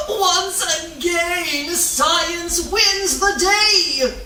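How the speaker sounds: high, slow, drawn-out triumphant declaration; grand proclamation. Acted. The heron declares science has won, slow grand proclamation. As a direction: proclaim victory like a stage hero.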